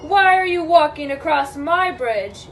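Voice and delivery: loud, demanding troll; storybook troll challenge; troll's yell. Acted. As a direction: yell it like a troll guarding his bridge. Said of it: "yelled the hideous".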